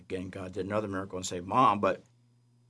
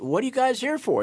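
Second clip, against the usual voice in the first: much higher, astonished; startled question; 13 semitones higher than usual. Retold or imitated. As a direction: ask the angels in alarm. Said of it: "And he says".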